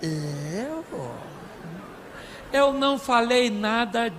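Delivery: slow, drawn-out mock-husband voice; imitated husband's denial. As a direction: play a husband denying it, stretching the words. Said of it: "Sabe o que ele fala?".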